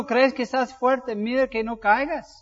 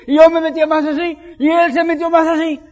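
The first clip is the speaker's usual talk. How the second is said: higher, louder and faster as it escalates; rising heat; 6 semitones higher, 10 dB louder than usual. Retold or imitated. Pastor relives jostling a surfer for the wave, getting heated. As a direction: let the rivalry heat up. Surf quarrel building up.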